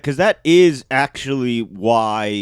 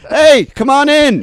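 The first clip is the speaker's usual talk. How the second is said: mock welcome; 10 semitones higher, 5 dB louder than usual. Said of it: "everyone just goes".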